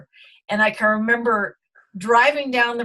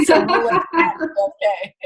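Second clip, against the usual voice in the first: higher, real laughter through the words; laughing; 7 semitones higher, 4 dB louder than usual. Real, not acted. Guest amused that the cat got in, laughing.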